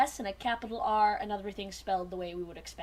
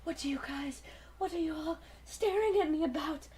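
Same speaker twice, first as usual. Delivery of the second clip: confused character; 5 semitones higher than usual. Acted. Rat Trap confused after transforming.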